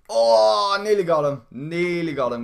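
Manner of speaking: loud high frustrated cry; excited shout. Real, not acted. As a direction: yell at the near miss. Near miss in fight.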